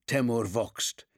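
ordinary delivery, nothing marked

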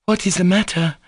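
put-on high, anxious girlish cry; real anxiety. Lisa hears of hysterics next door, anxious cry. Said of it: "in a tone of real anxiety".

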